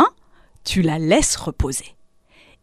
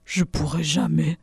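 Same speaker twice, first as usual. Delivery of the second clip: disheartened; 4 semitones lower, 5 dB quieter than usual. A narrator's reading. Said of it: "avec un air désolé".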